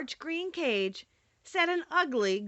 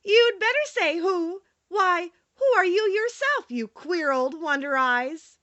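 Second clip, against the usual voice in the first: put-on high, loud, merry child's reply; a little girl cheerfully teasing the owl; merrily; 7 semitones higher, 5 dB louder than usual. A narrator's reading. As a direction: tease the owl brightly, higher and louder. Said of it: "she answered merrily".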